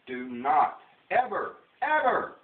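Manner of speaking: "ever" repeated, jumping high and loud; slow "do not", then holler the last "ever"; building mock-dramatic warning, peaking on "ever"; emphatic warning.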